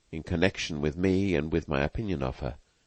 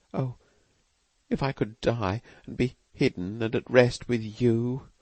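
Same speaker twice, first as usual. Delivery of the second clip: passionate murmur; 6 semitones higher than usual. A narrator's reading. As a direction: pour out love at a grave. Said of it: "murmured them in tones of passionate endearment".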